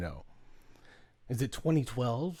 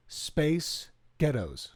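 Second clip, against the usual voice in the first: Scottish accent; 4 dB quieter, much slower than usual. Shown as a demonstration. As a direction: say "Space ghettos" slowly, the Scottish way. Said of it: "learn how to use a Scottish accent".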